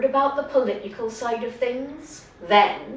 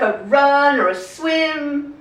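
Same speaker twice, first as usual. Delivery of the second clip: ordinary talk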